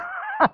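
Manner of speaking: sudden high burst of laughter; amused high laugh. Real, not acted. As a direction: laugh out at their excitement. Preacher laughs at congregation.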